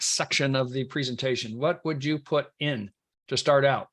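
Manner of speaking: usual speaking voice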